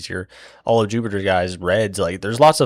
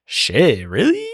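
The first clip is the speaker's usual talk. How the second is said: a sudden squeak of disbelief on really, much higher; shocked disbelief; 6 semitones higher, 4 dB louder, slower than usual. Retold or imitated. Jupiter lord hearing the fleet was given up, squeaking in disbelief. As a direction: blurt it out, caught off guard, voice jumping up high. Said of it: "the Jupiter guy's like".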